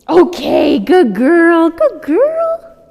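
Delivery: loud, very high dog praise; high squeaky praise. Real, not acted. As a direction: gush over the puppy, high and loud.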